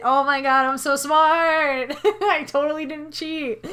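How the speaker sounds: slow, smug self-praise. Acted. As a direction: gloat with playful smugness. Mock self-praise after cheating.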